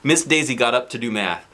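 plain reading voice

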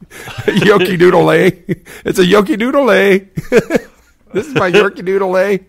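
high, loud, laughing through words; cracking up on each repeat. Real, not acted. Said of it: "we can't stop laughing".

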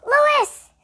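high, loud call; shout. A narrator's reading. Stephen calling down to Lewis, loud shout. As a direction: call him from the stairs. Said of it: "he shouted".

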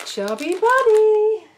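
drawn-out excited announcement; slow, high, loud announcement; announcing. Real, not acted. Announcing the challenge name.

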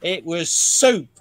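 hammed-up Australian storyteller; Australian accent. Reading a soldier's anecdote to show off an Australian accent. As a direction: read it in a put-on Australian accent. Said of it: "the almost perfect Australian accent".

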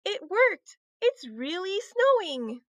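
high, thrilled exclamation; squealing delight. A narrator's reading. Heidi seeing the wand's magic work, delighted cry. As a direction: marvel in high-pitched delight. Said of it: "They both squealed with delight".

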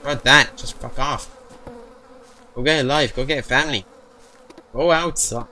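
loud mocking insults; angry yelling. Real, not acted. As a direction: shout the monster away. Yelling at game monster.

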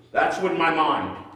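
usual speaking voice

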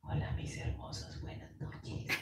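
whispered, slow and soft; slow whispered reading. Shown as a demonstration. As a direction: whisper the message slowly. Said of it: "Yo la voy a leer despacito, así como cuando ella habla".

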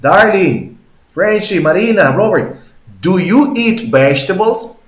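high, loud call to a whole group, slow on the question; teacher calling several students at once; calling out to the class. Shown as a demonstration. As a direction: call out to everyone in the room. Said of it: "si yo digo".

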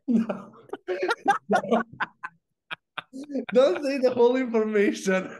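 high, loud laughing no; laughing protest. Real, not acted. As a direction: beg them through laughter not to tell.

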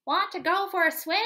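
fast, loud duck voice. Acted. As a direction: chatter like a busy duck. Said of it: "called the duck".

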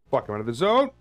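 loud frustrated outburst; frustrated shout. Real, not acted. Frustrated outburst mid fight.

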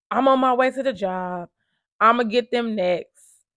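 imitating a man set on going after people; retold threat. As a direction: relay his threat loud and emphatic. Quoting husband's angry threat.

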